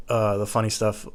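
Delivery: normal talking voice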